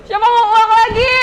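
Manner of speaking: loud, high shout to the audience; calling out. Real, not acted. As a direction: whip up the crowd with the question.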